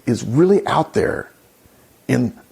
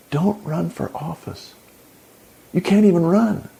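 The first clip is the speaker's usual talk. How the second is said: ordinary talk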